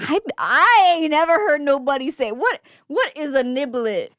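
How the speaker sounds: high, loud put-on catchphrase voice. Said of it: "I should have looked up [name] phrases".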